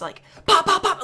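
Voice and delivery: light popping sounds; mild gunshot imitation. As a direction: pop them out quickly.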